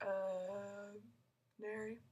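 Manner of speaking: long "Uh", a gap, then "Nary"; pause before name. Shown as a demonstration. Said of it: "pause, and then I go".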